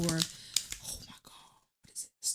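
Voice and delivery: hushed whisper of surprise; real surprised whisper. Real, not acted. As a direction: breathe it out, startled by the card. Surprised by a fallen card.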